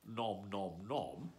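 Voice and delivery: soft mock chewing sounds; munching noises. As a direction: smack the lips as if eating. Eating sounds while reading.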